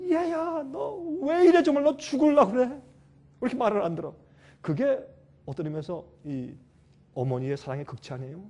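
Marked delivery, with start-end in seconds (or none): 0.1-4.1 a high, exasperated scolding, easing off at the end; scolding mother. Retold or imitated. Mother hitting a stubborn child, scolding out of love. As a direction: scold the child like a frantic mom.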